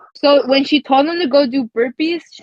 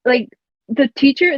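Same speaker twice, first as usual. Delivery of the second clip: usual speaking voice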